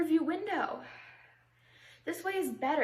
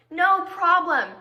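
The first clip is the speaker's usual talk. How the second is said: loud, drawn-out "no problem"; slow, loud mock agreement; exasperated repeat; 3 semitones higher, 10 dB louder, slower than usual. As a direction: stretch it out with heavy irony.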